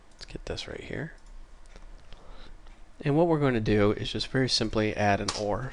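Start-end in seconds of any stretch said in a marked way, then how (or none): 0.1-1.1 whispered aside to himself; whispering. Real, not acted. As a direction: drop to a breathy whisper. Muttering to himself while fixing code.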